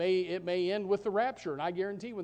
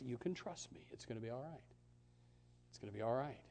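soft voice of God; 6 semitones lower, 12 dB quieter, faster than usual. Acted. God's reassuring voice.

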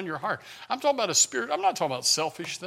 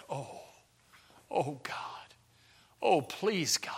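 soft, halting plea to God; hushed prayer; 10 dB quieter, slower than usual. Retold or imitated. Preacher showing someone really praying to God, hushed pleading. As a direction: pray under your breath, imploring. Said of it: "the last time you prayed to God".

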